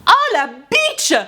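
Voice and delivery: very high, slow, drawn-out gasp of outrage; shocked outrage. Real, not acted. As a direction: stretch it out, scandalised. Outraged reaction to the story.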